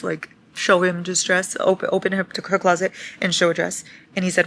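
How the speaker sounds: ordinary talk, nothing marked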